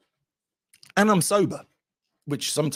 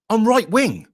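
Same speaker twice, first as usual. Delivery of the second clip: higher, louder proud announcement; telling the canvassers his politics, defiantly loud; defiant declaration; 11 semitones higher, 8 dB louder, slower than usual. Retold or imitated. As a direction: announce it with pride.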